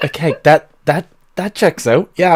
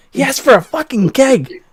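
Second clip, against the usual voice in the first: high halfling voice; 12 semitones higher than usual. Acted. Halfling argues with gnome.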